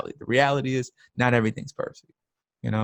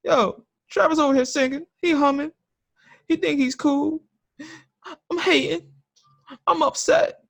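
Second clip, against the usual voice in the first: imitating online haters in a much higher mocking voice. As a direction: squeal it like a petty hater. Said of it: "the haters gonna be on the comments like".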